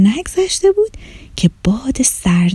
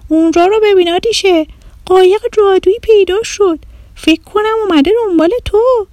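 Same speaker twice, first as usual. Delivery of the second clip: excited friend; 9 semitones higher than usual. Acted. Friend points out magic boat.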